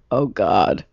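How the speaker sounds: low, subdued "waking up to dread" tone; a touch of morning dread; dreading groan. Retold or imitated.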